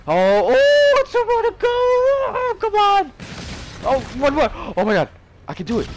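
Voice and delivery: high, loud, frantic yelling; frantic excited shouting. Real, not acted. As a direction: scream it as the streak nears. One kill from the nuke.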